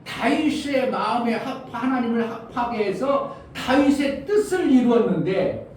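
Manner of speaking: high, forceful sermon delivery; impassioned preaching. Real, not acted. Preacher urging the congregation to show Jesus' glory, impassioned. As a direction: drive each point home to the congregation.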